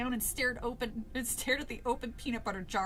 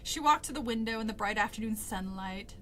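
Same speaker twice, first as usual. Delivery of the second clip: ordinary delivery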